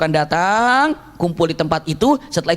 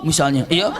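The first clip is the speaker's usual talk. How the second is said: ordinary talk, nothing marked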